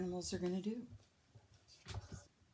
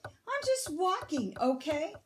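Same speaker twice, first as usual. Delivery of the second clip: much higher, loud and fed up; exasperated shout; 10 semitones higher, 4 dB louder than usual. A narrator's reading. Grumpy Bird yelling at Fox, much higher and fed up. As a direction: yell it out, fed up. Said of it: "shouted Bird".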